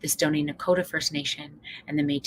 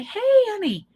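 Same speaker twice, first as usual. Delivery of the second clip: gentle honey; 14 semitones higher than usual. Retold or imitated. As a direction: coax her gently and kindly. So I'm gonna say.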